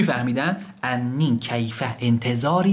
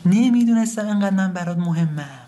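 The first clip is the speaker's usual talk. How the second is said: high, falling cry of regret; wailing lament; 8 semitones higher, 3 dB louder than usual. Acted. Son grieves over his dead father, wailing lament. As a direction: wail it out, pitched high. Lament repeated with more force.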